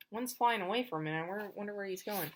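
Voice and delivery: ordinary delivery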